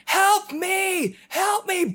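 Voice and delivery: high mock cries for help; imitating a man screaming. Retelling the stranger below screaming for help, high mock yells. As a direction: pitch up high like a distant man yelling help. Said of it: "a man just screaming".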